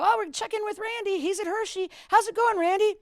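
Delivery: high, loud on-air announcer voice; radio host voice. Retold or imitated. DJ throwing to the reporter on air, announcer brightness. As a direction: pitch it up like a DJ going live. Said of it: "I go".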